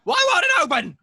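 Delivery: high, loud panicked cry; frantic, high-pitched yell; desperate shout. Acted. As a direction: yell in despair at the hatch. Trapped, hatch won't open.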